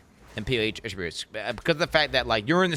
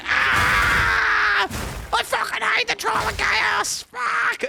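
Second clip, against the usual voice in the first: much higher, louder, slower panicked shout; frantic yell; 5 dB louder, much slower than usual. Retold or imitated. Streamer reenacting fleeing the Troll of Chaos, frantic shout. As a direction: scream it like a headless chicken. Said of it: "running around like a headless chicken going".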